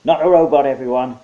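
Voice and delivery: loud soldier shout to the troops. Acted. Soldier shouting to fellow troops. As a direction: call it out to everyone. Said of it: "he shouted".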